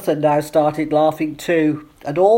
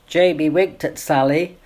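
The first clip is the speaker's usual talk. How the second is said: ordinary delivery, nothing marked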